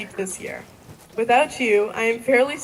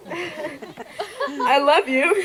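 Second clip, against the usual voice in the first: laughing; 4 semitones higher, much slower than usual. Real, not acted. Thanking her dad, laughing.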